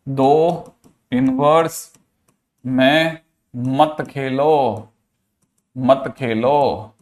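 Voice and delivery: drawn out word by word, with pauses; slow emphatic repetition. Shown as a demonstration. Teacher drilling a key rule into students, slow emphatic repetition. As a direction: spell out the rule very slowly, stretching each word.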